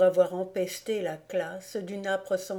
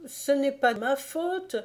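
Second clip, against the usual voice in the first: stammering; 7 semitones higher than usual. A narrator's reading. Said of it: "bégaya le misérable Lippmann".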